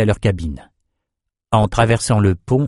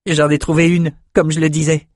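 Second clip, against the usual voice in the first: lightly voiced, higher-pitched youth; a hint of boyish eagerness, pitched up; eager young man; 6 semitones higher than usual. A narrator's reading. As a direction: show off the new find. Eager young man shows his sword.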